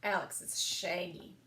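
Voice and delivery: ordinary delivery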